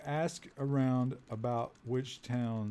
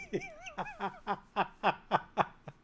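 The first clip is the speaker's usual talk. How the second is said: real laugh, much higher; helpless cackle of disbelief; wheezing high laugh; high-pitched laugh. Real, not acted. Loud laugh after baby trade.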